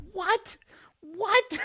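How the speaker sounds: high, baffled double what; can't believe what you heard; a sharp disbelieving what, then a falling one; incredulous what. Retold or imitated. Baffled reaction to friend's excitement.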